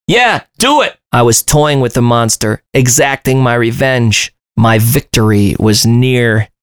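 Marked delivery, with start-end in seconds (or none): 0.1-1.0 high gloating shout; high eager taunt; spoke up. A narrator's reading. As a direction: egg on the caretaker. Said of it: "I spoke up".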